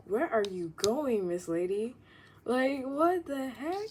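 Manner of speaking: called out loud across the way to a running stranger; loud playful call. Real, not acted. Calling after a woman running with her hat off, loud and teasing. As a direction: shout after her, half joking, the last words slow.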